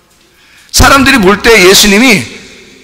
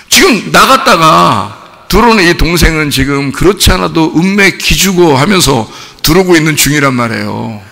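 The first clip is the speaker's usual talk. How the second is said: ordinary talk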